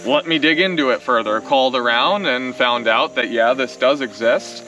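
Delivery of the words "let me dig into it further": ordinary delivery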